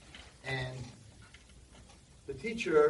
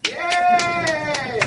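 very high, loud cheer; kid cheering; 17 dB louder than usual. Retold or imitated. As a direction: cheer like a proud little kid. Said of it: "I'm like".

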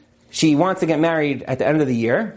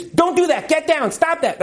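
mock parent yelling; 10 semitones higher than usual. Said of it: "the yelling and screaming".

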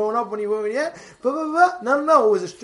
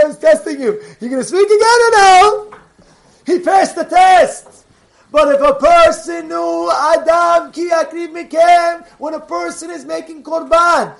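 loud, high, driving sermon voice; fiery preaching; 8 semitones higher, 8 dB louder than usual. Acted. Rabbi firing up his listeners with a loud sermon. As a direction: preach it out to the congregation at full force.

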